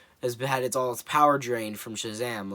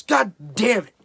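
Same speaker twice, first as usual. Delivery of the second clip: higher, louder frustrated curse; mock shout; 10 semitones higher, 11 dB louder than usual. The villain curses as the caterpillar leaves, loud hammed-up yell. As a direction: blurt it out as a fed-up yell.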